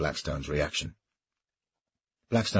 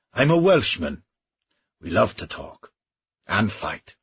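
a lightly raised, mocking Welsh knight; Welsh mercenary voice; 5 semitones higher, 5 dB louder than usual. Welshman taunting his old comrade, lightly mocking voice. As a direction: goad him with easy bravado. Griffith boasts of his people.

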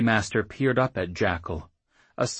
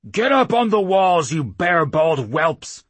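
bellowing at the recruits, much louder; barked order; 10 semitones higher, 9 dB louder than usual. A narrator's reading. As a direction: roar it like an angry old warlord. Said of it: "the chief barked".

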